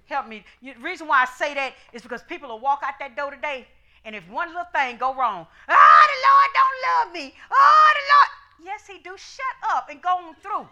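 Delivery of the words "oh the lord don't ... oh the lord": high, loud mock whine of a self-pitying believer; whiny complainer. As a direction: wail it like someone sure God left them.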